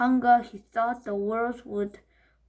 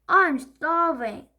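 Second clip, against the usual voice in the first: slow, high, loud whine; wail; 6 semitones higher, 6 dB louder, slower than usual. Acted. Henry wailing that he is starving. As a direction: moan it out long. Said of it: "wailed Horrid Henry".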